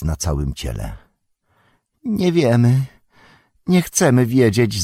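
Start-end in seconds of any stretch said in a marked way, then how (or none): 2.0-4.8 high moaning creature voice. A narrator's reading. Gollum cowers before Faramir, high moaning. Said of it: "zajęczał".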